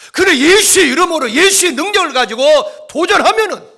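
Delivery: high, full-force pulpit shout; rally the congregation at the top of your voice; fervent preaching. Real, not acted. Preacher surges into full-force exhortation. (힘차게 외치는 부흥회 설교)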